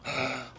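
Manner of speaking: sharp quiet surprised gasp; mock gasp of surprise. Seeing the barn appear, a hammed-up gasp. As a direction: pretend to be stunned.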